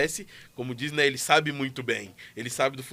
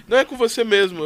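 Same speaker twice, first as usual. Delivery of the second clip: caller's reply; 6 semitones higher, 8 dB louder than usual. Retold or imitated. Caller answers, voice retold.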